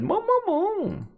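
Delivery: squeaky high creature "mo mo mo"; high-pitched little critter sounds; cute creature chirp. Pretend. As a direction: chirp it like a tiny pet creature. Creature noise in game.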